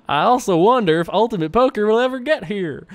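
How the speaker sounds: high, hammed-up, not very Texan accent; failed Texan accent. Reading a Texan viewer's email, badly faked Texan accent. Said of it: "that's not really very Texan".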